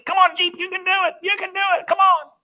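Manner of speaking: high, far-off urging cheer; coaxing cheer. Pretend. Preacher coaxing his dad toward change, urging high cheer.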